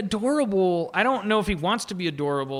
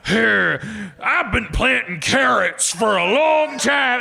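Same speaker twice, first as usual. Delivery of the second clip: mock old farmer; 3 semitones higher, 4 dB louder, slower than usual. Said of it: "then he'll do, like".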